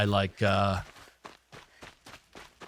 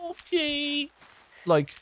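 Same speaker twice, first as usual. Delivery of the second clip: put-on high, whiny baby voice; slower than usual. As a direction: talk like a toddler. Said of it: "I'm talking about, like, being like".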